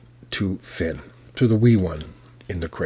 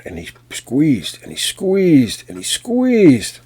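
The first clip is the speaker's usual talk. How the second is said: each squeezed higher, louder and slower; rising strain; 8 semitones higher, 6 dB louder, slower than usual. A narrator's reading. Narrator acting out the giant's squeezing, straining harder each time. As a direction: build the effort with every "squeezed".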